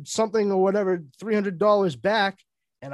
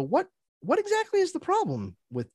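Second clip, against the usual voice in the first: high-pitched, puzzled query; acted-out confusion, voice lifted high; baffled questioning; 10 semitones higher, 3 dB quieter than usual. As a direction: pitch jumps up in mock bewilderment. Puzzled question to wife, retold.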